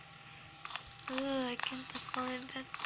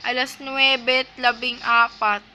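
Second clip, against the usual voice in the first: loud announcing; 13 dB louder than usual. Real, not acted. Announcing time loudly.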